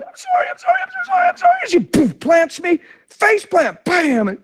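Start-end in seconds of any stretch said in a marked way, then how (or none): 0.0-1.7 high-pitched, pleading wife voice; wife's sorry. Retold or imitated. Preacher voicing his wife's apology, high and pleading. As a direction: imitate the wife begging sorry over and over.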